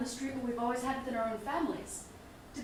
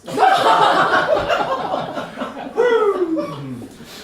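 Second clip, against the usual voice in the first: loud burst of laughter; 8 semitones higher, 17 dB louder than usual. Acted. Laughs at own joke.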